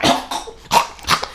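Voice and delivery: mock coughing fit; imitated coughing. Storyteller imitating a classmate who kept coughing, mock hacking coughs. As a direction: cough repeatedly, imitating someone. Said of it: "but she kept coughing".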